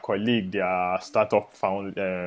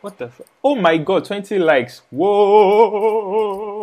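excited; 10 semitones higher, 7 dB louder, slower than usual. Retold or imitated. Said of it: "and I'm like".

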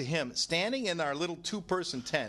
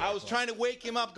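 ordinary delivery

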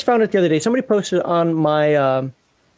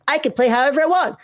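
mock protest; 6 semitones higher than usual. Mimics player he argues against.

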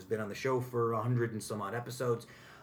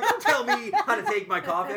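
higher, louder, laughing mock protest; mock indignant thought; 8 semitones higher, 7 dB louder than usual. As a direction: play the offended customer, half laughing. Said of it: "In my head, I'm like".

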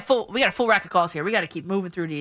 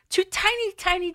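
sudden upward crack in the voice; 11 semitones higher than usual. Real, not acted. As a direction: voice breaks up high mid-word. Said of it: "my voice just cracked there".

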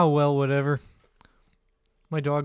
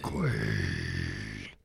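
deep voice; 4 dB quieter than usual. Pretend. Answers with Kuato quote.

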